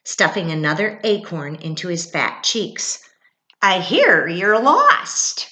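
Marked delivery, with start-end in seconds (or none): 3.6-5.5 loud and slow chipmunk. Acted. Chipmunk continues.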